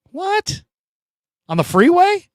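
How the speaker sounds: shocked squeak of disbelief; incredulous what. Real, not acted. Reacting to a viewer's speeding story, high and shocked.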